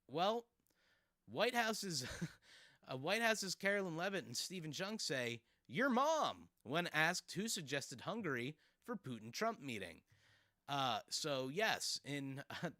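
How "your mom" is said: high, loud, drawn-out 'your mom'; exaggerated juvenile retort; mock jeering comeback. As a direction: belt it out like a playground taunt. Quoting officials' jeering comeback in headline.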